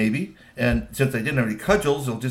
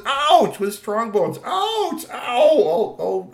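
very high, yelping ouch; pained yelps; 10 semitones higher, 6 dB louder, much slower than usual. Acted. Man hit by his own friend, yelping in pain. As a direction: yelp as the blow lands. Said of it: "the first sound she said was".

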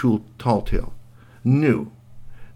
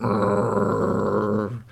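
scowling growl. Acted. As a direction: growl it like a grumpy pirate. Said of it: "the other two boys went".

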